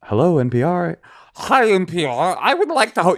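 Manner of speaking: high, lightly lisping voice; NPR applicant. Retold or imitated. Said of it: "application process to do a radio show on N P R".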